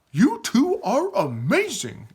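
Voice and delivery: high, slow exclamation of wonder; amazed. Acted. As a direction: gush in awe, drawing it out.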